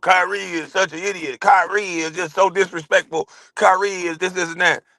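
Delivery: high, sneering pundit mimicry; mocking pundit voice. Imitating critics bashing Kyrie, high and sneering. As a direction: mimic the media piling on Kyrie.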